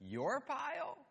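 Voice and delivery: high, amused disbelief; incredulous. Retold or imitated. Dad questioning his son's claim, high and incredulous. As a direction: ask it like you can't believe it. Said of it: "my question to the, him was".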